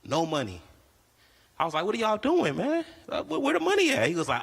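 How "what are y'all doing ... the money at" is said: high incredulous questioning; baffled. Retold or imitated. Comic asking kids where the money is, high and baffled. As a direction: squeak up in disbelief.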